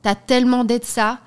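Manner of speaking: reading a love letter with flair; dramatic letter reading. Acted. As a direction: perform the letter like a declaration. Reads a love letter aloud.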